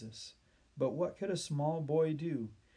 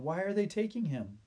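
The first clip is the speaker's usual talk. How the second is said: high-pitched, upset demand; demanding question; 7 semitones higher than usual. A narrator's reading. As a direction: press for an answer, voice raised high. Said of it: "demanded Benjamin".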